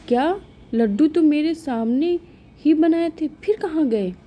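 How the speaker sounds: high, rising alarm over the missing sweets; shocked disbelief. Acted. Mother-in-law hearing the laddoos are gone, shocked.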